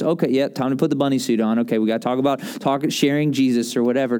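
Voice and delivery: low, quick, unenthused going-through-the-motions; grudging duty. Acted. Church member facing evangelism as a chore, grudging and low. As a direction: rattle it off with no eagerness, pitch dropped. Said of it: "kinda like one of those".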